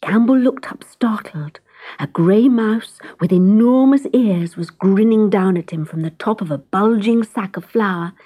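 plain reading voice

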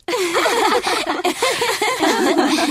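high, light laughing together; girlish laughter. Acted. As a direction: laugh along like delighted sisters. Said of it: "the four sisters laughed".

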